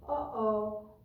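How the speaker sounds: ordinary delivery, nothing marked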